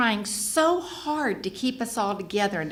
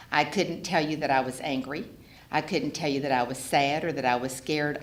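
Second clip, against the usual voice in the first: ordinary talk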